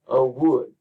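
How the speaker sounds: light "a", then hit "wood"; iambic stress. Shown as a demonstration. Teacher scanning the last foot, stress on WOOD. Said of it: "it's predominantly iambic".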